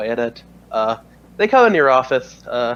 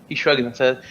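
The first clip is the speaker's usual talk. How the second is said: ordinary talk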